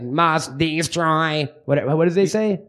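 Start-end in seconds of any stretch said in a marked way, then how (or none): 0.0-1.5 very slow robotic drone; Dalek voice. Retold or imitated. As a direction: grind it out like a killer robot. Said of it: "on the T V and they're like".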